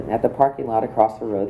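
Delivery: usual speaking voice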